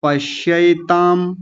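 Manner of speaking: पश्यैताम slow, with a clear ऐ; careful pronunciation. Shown as a demonstration. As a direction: sound out पश्यैताम. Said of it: "ऐ की मात्रा है ये".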